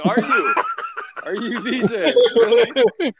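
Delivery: squeaky high, loud, laughing mock question; laughing disbelief. Mocking Adam's DJ excuse, squeaky laughing disbelief. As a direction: tease him, barely holding in laughter. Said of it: "And I'm like".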